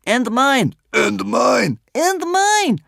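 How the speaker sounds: high, loud calls one after another; chorus of wanderers. A narrator's reading. Wanderers join the testimony, calling out in turn. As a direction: chime in as several different high voices. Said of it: "a chorus of other wanderer voices".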